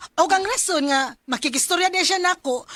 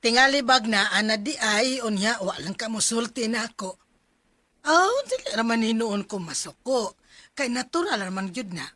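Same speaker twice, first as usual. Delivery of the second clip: low, soft motherly voice, gently advising; a calm mother talking to her daughter; mother gently advising her daughter about boyfriends; 7 semitones lower, 4 dB quieter than usual. Acted.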